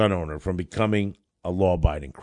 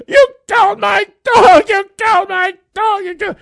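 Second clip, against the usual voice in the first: shrill high scream, much louder; 9 dB louder than usual. Retold or imitated. Grieving woman screams at the lawyer from the courthouse steps, high furious scream. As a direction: scream the accusation from the top of the steps. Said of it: "she's screaming at the top of the courthouse steps".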